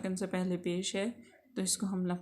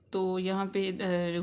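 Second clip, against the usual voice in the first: ordinary talk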